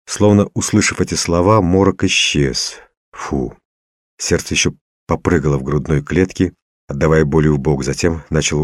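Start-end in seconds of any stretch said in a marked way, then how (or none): none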